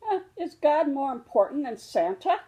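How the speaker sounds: a small cub wondering aloud; curious little cub. Acted. Little cub asks Mama about Santa, wide-eyed and childlike.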